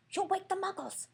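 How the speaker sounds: high, sharp hissed warning; high scolding hiss. Acted. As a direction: hiss it high to shush him. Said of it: "hissed Professor McGonagall".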